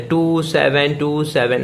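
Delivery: "टू seven टू seven" slowly, digit by digit; slow teaching demo of "टू seven टू seven", each digit apart; broken digit by digit. Shown as a demonstration. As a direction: read "टू seven टू seven" as separate, drawn-out digits. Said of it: "उसको break करके पढ़ना होता है".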